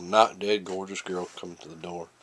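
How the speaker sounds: ordinary delivery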